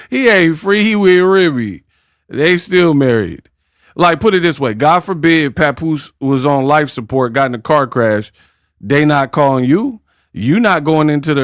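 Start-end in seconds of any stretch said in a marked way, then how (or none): none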